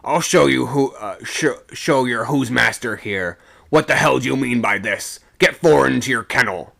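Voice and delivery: loud, bullying bellow; domineering, forceful yelling; angry shout. A narrator's reading. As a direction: shout them back into line. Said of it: "he shouted".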